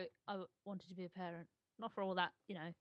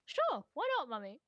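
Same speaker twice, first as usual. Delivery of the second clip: put-on child's voice; 6 semitones higher, 6 dB louder than usual. Imitates son's reply, high-pitched.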